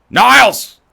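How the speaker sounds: hammed-up sitcom psychiatrist bellowing his brother's name; Frasier Crane impression. Streamer tries his Frasier Crane impression on chat, a loud exasperated call. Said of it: "I'm gonna do it now".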